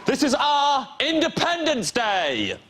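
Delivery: high, slow Scottish rallying shout; Scottish accent. Acted. Comic rallies the crowd for Independence Day, Scottish shout. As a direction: roar it in a Scottish accent like a battle speech. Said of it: "imagine this in a Scottish accent".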